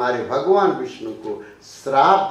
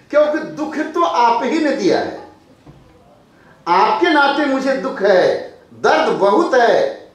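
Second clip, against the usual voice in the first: pained plea; 10 semitones higher, 7 dB louder than usual. Acted. Tulsidas pleading to God.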